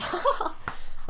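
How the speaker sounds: high burst of laughter; laughing. Real, not acted. Host laughing at own script, real laugh.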